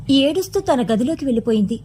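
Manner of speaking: ordinary delivery, nothing marked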